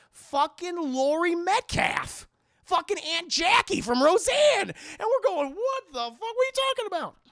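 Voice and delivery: loud high excited disbelief; hyped shock. Real, not acted. Commentator reacting to the twist, loud excited disbelief. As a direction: yell the reveal in shock.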